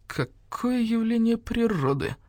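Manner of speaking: sneering exclamation. A narrator's reading. Dog sneers at the rook.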